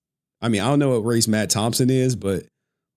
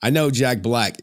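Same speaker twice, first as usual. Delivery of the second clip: normal talking voice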